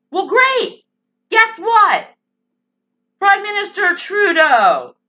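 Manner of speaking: high, loud sarcastic retort; mock calling out. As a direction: call out high and loud to a politician. Acted retort calling out Trudeau.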